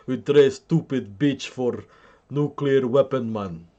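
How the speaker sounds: mock Russian accent. Russians gloating over the trade, in a mock Russian accent. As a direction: put on a comic Russian accent. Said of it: "Beautiful Russian accent".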